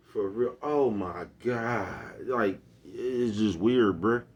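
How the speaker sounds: lightly mocked phone gossip with drawn-out words; mock gossiping women. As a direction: imitate a drawn-out, gossipy "oh my God". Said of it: "Y'all sound just like a bunch of bitches on the phone".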